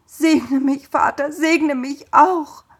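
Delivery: high, loud, drawn-out pleading cry; desperate cry. A narrator's reading. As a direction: beg with everything he has. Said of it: "Da schrie Esau auf".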